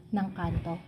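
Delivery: hushed question, barely voiced; whispering while hiding. Real, not acted. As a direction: keep it down so nobody hears. Hushed secret plan, asks. (pabulong na tanong, nagtatago)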